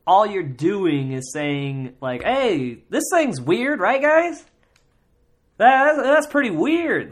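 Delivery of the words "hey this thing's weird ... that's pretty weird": much higher, louder mock Norm Macdonald; bad Norm Macdonald. Mocking hack comics doing a bad Norm Macdonald, loud and high. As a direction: overdo a cheap Norm Macdonald bit. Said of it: "almost turn it into a Norm Macdonald".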